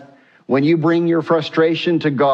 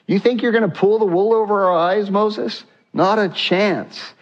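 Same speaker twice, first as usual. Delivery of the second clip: high, mocking taunt; mock rebel defiance, high and scornful; sneering defiance; 7 semitones higher than usual. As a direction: scoff at him like he's a fool, pitched up.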